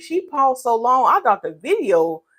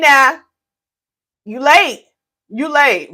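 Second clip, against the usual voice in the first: mock scolding; 5 semitones higher, 6 dB louder, slower than usual. Teasing late moderator.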